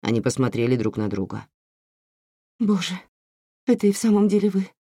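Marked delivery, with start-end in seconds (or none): 2.6-4.7 high, fast, trembling voice; shaky voice. Acted. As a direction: let the voice shake with emotion. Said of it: "дрожащим голосом сказала Фьяма".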